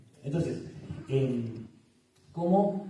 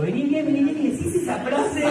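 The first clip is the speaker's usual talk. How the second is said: laughing; 10 semitones higher, 5 dB louder than usual. Real, not acted. Joking about husbands' blunder.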